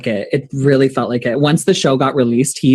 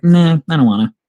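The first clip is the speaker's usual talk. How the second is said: a lightly voiced bratty refusal; drawled mock disinterest, teasing; teasing brush-off; 3 semitones higher than usual. Drag queen teasing a fan, lightly bratty refusal. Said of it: "just to be an asshole".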